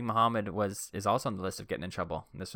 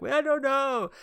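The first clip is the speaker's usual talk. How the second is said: put-on much higher, whiny little-girl voice; girl telling counselors she tripped, high whiny; hurt girl; 6 dB louder, much slower than usual. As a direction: play the injured kid, plaintive.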